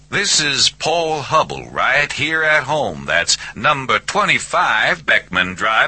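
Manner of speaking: high Southern voice, playfully announcing; speakerphone recital. A narrator's reading. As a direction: recite it for the meter down a tinny line.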